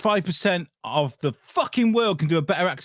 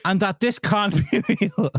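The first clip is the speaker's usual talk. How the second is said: high, slow Irish attempt breaking into laughter; laughing mock-Irish quote; attempted Irish accent; 7 semitones higher, slower than usual. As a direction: read in put-on Irish, cracking up. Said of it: "trying to do it with an accent".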